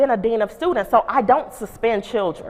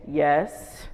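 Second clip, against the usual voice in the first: lower, soft, deep-voiced yes; deep student voice; 7 semitones lower, 5 dB quieter than usual. Student Jonathan answering the principal in a low, deep voice. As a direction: answer low like a boy with a grown man's voice. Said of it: "when did Jonathan's voice get so deep".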